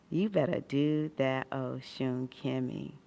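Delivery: ordinary talk, nothing marked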